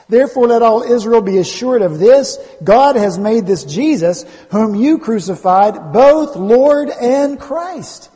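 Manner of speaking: loud, ringing scripture proclamation, drawn out; full-force proclamation. Real, not acted. Preacher declaring Peter's Pentecost message to the church, full-force proclamation. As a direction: build to a high, loud, slow climax.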